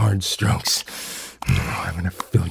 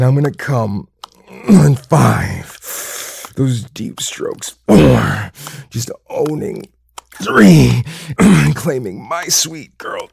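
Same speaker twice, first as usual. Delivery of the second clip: rising, louder breathless countdown; excited; 5 semitones higher, 8 dB louder than usual. Acted. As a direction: build to a loud peak. Building countdown to climax.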